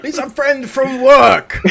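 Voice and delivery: high, loud, slow grinning shout; Thor grinning. Retold or imitated. Player quoting Thor's hero greeting, high loud slow shout. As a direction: draw out the line with a huge grin. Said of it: "like Thor in Ragnarok".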